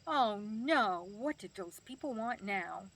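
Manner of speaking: loud, drawn-out mock dismay on "Oh no"; playfully retold toddler indignation; dismayed little granddaughter. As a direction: imitate a child's exaggerated protest. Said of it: "when her parents would come to pick her up, she'd say".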